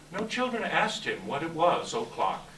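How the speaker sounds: ordinary talk, nothing marked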